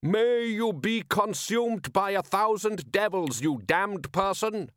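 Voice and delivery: high, slow curse sung over water; singsong curse. Acted. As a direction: draw the insult out in a high singsong. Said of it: "A voice sang over the water".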